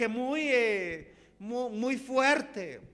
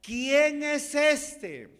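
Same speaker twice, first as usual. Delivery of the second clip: loud, slow, higher question to the congregation; emphatic preached question; 4 semitones higher, 6 dB louder, much slower than usual. Real, not acted. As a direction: throw the question out to the church, slowly. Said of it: "¿Cuál es la pregunta?". (pregunta predicada, fuerte y lenta)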